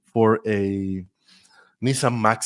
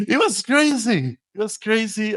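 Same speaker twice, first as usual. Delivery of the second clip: excited laugh; 10 semitones higher than usual. Real, not acted. Guest recalling landing the job, laughing and excited. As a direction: still thrilled by the memory. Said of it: "I was so excited".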